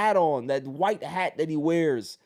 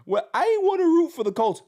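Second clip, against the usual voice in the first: mocking whiny kid; 12 semitones higher, 5 dB louder, faster than usual. Mocking kid's quote.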